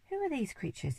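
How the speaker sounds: high-pitched indignant outburst; indignant bellow. A narrator's reading. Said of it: "he bellowed".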